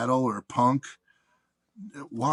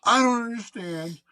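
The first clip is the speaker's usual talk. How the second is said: high, exasperated complaining woman, laughing through it; mock the frustrated caller's whine; hammed-up annoyed customer; whiny caller; 8 semitones higher than usual. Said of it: "she's like".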